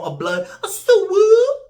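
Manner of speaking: shrill high yelled gang call; mock blood call. Imitating a blood's call, loud and high.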